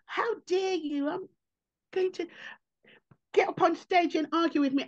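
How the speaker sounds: a hint of an outraged audience member; mock indignant heckler. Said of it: "audiences want to now go".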